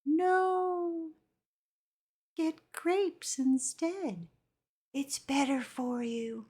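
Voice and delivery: slow, drawn-out coaxing inner voice; a lightly voiced little head voice, slow and gentle. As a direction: talk them out of the candy like a patient conscience. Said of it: "that little voice in the back of your head says".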